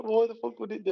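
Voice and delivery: high, baffled quote; retold incredulity. Repeats the mate's incredulous question.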